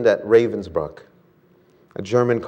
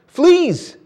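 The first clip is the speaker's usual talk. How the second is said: sudden high startled cry; cry of alarm; 5 dB louder than usual. Acted. Corrie cries out to Betsy in alarm. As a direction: jolt up and cry out at the bite. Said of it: "she cried".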